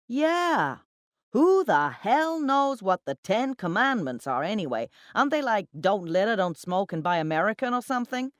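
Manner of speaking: loud, high-pitched tipsy mockery; boisterous scoffing colleague. A narrator's reading. Colleague chiming in at the bar, loud and mocking. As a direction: toss it out loudly to get a laugh.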